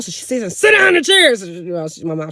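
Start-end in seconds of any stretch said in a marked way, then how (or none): 0.6-1.3 high, loud mock order; bullying shout. Aunt bullying mom into the chair, loud high shout. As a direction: imitate a bossy sister yelling. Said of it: "she bullies my mom".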